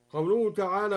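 usual speaking voice

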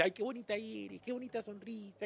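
high, soft, cooing mock flattery; mock flatterer. Mocking people who gush over Milagritos, in a high sugary coo. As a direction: coo it like a fawning admirer. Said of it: "cuando alguien te dice".

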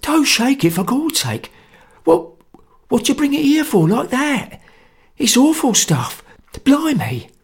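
high, loud panicky voice; terrified pleading. Acted. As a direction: beg them to stop, scared stiff.

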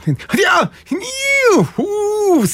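high hammed-up cry of pain; mock pain cry. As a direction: wail like your back just locked. Groaning in pain on waking.

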